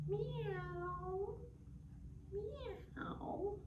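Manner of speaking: high, soft little meows; a small lost kitten calling faintly; tiny kitten meow. Acted.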